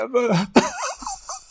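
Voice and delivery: high blubbering wail; acted high-pitched crying burst; mock crying again. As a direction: break into an imitated sob. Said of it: "crying again".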